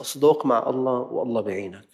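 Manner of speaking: usual speaking voice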